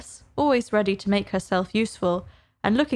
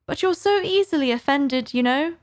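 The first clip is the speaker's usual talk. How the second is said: coaxing; 6 semitones higher than usual. Acted. Alice coaxing the mouse.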